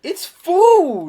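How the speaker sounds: very high, loud outburst; exasperated shout. Real, not acted. Arguing with friends that food is trivial, exasperated shout. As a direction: yell it in real disbelief.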